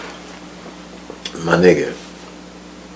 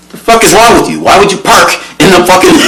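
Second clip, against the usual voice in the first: mock scolding; 10 semitones higher, 4 dB louder than usual. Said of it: "he get to jabbing".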